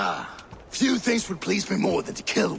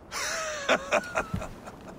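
evil laugh; 4 dB quieter than usual. Acted. Villain gloats over captive.

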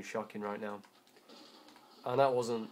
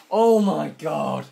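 very loud, high, drawn-out yell; outraged shout; 6 semitones higher, 18 dB louder, much slower than usual. Real, not acted. Player killed in the game, outraged shout. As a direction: bellow it slowly in disbelief.

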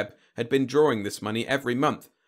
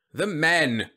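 loud, higher-pitched irritated answer; irritable; 7 semitones higher, 5 dB louder than usual. A narrator's reading. General correcting confused Whitlow, irritable. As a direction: answer with open impatience. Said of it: "said the general irritably".

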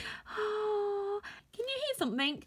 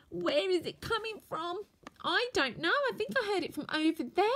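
ordinary talk

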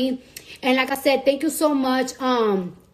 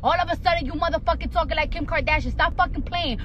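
ordinary talk, nothing marked

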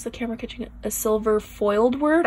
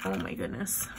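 awed whisper; 5 semitones lower, 9 dB quieter than usual. Real, not acted. Awed by tiny details.

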